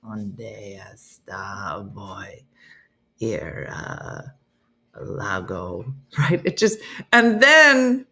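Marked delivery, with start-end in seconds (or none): 0.0-5.9 very low, soft, slow creepy man; hushed, deep Spanish-app man; Duolingo man voice. Retold or imitated. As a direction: mimic the lesson man, deep and drawn out. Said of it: "It's just this really".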